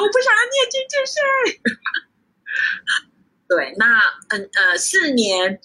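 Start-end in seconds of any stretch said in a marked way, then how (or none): 0.0-1.1 very high, fast mock wail; hammed-up sobbing complaint; crying whine. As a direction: imitate a teenager's tearful protest. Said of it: "我那时候在电话里面大哭".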